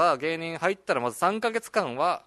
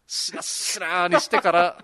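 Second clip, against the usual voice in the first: slow, stretched しなしな, pitched up and louder; drawn-out gag; 4 semitones higher, 5 dB louder, slower than usual. Pretend. Comedian milking the soggy rice cracker joke, drawn out. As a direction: draw しなしな right out, playfully milking it.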